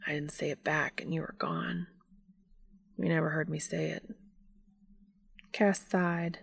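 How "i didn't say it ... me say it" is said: hushed, half-whispered regret; soft, pained admission; increasingly strained. A narrator's reading. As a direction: confess softly, voice under strain. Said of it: "His voice was getting more strained with each sentence".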